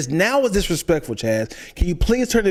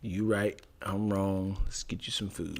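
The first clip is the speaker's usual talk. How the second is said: much softer, fading apology; mock sympathetic apology; 10 semitones lower, 13 dB quieter than usual. Apologizing to upset girlfriend, soft and fading. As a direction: soften it right down to soothe her. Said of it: "This is how you do it".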